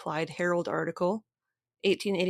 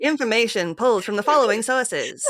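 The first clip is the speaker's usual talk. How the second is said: put-on old-timey radio voice; 4 semitones higher, 7 dB louder than usual. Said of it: "newspapery radio voice, old-timey radio voice".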